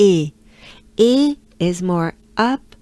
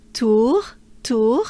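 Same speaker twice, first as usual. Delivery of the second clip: rising tour; 3 semitones higher, 4 dB louder than usual. Shown as a demonstration. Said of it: "We go up a bit".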